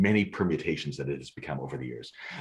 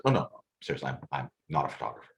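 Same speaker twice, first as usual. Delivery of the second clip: serious; 7 semitones lower, 5 dB quieter, faster than usual. Retold or imitated. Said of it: "I stop and I get serious".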